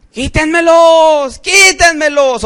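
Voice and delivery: high, slow, desperate cry of a tormented soul; anguished plea. Retold or imitated. Preacher voices a soul begging to remove worms, high anguished cry. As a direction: beg them to take the worms off. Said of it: "Algunos pidiendo que le quiten los gusanos".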